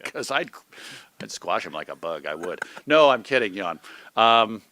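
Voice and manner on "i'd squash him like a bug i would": mock menace. Joking threat, then says kidding.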